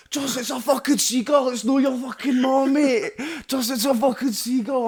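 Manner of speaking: high, loud mock-panic yell; retold panicked yelling. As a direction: yell it like your mate freaking out over a seagull. Retelling mate's panic over seagull.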